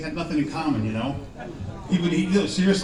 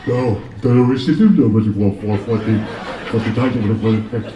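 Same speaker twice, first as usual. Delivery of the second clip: lower, louder dazed boxer voice; punch-drunk boxer; 6 semitones lower, 6 dB louder than usual. Acted. Boxer answering his friend, low and dazed. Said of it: "second boxer answering".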